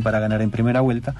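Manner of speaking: ordinary talk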